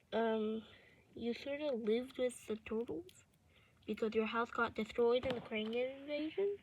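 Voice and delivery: ordinary delivery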